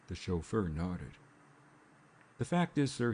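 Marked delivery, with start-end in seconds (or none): 2.4-3.1 sudden higher burst; blurted out. A narrator's reading. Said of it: "he blurted out".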